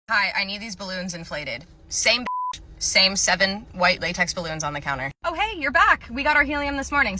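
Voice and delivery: ordinary talk, nothing marked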